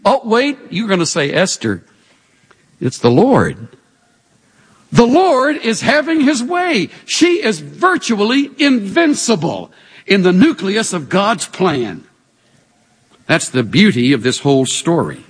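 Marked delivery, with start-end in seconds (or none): none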